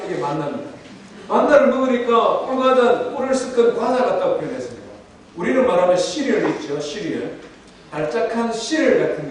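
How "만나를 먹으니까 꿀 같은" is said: ordinary delivery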